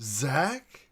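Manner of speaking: loud, rising disbelieving call; surprised call. Real, not acted. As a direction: call out the name in shock. Calling out in surprise.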